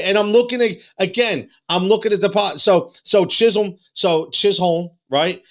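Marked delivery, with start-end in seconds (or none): none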